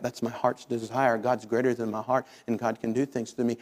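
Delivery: low, soft, subdued plea; quiet prayer. Acted. Believer asking the Lord for victory, low and quiet prayer.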